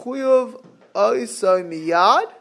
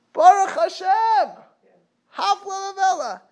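overjoyed; 13 semitones higher than usual. Acted. Avram thrilled at a chance for kindness, high and joyful. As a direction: exclaim it in elated relief. Acting Avram delighted by chesed.

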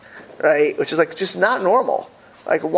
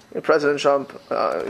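ordinary delivery

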